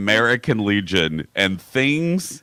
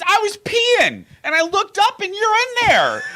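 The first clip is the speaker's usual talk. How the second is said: retold high, loud outburst; imitated yelling; 4 dB louder than usual. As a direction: imitate a friend hollering up in falsetto. Imitates friend yelling her explanation.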